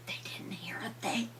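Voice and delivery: whispered, hushed. A narrator's reading. Narrator hushes to show the morning silence, whispered. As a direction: breathe it out in a hush.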